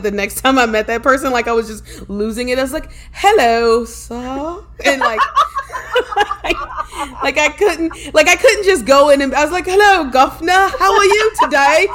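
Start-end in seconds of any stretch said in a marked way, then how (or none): 3.1-4.6 high, put-on British greeting; fake British accent. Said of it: "slowly draw in this accent". 9.7-11.9 loud, high-pitched mock Cockney; hammed-up British greeting, loud and high; fake British accent. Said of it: "slowly draw in this accent".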